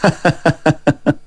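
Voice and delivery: soft, steady run of ha-ha; gentle acted laugh; soft chuckle. Fortune-teller about to explain his reading, chuckles softly. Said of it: "李太白微微一笑".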